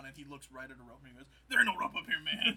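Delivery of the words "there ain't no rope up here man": loud, high comic jazz musician; Max Rebo. Acted. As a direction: holler back like an oblivious gigging musician. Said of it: "Max Rebo's voice is also very funny".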